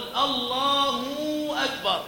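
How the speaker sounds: الله أكبر with a long "hoo" ending الله; over-lengthened damma. Shown as a demonstration. Teacher demonstrates an adhan error, over-stretched damma on الله. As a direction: slowly stretch the u after the h in الله. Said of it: "الزيادة أو إشباع ضمة الهاء".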